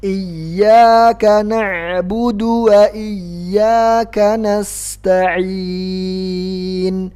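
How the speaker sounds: slow chanted verse, dal kept short; correct recitation. Shown as a demonstration. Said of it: "dalnya nggak ada matnya".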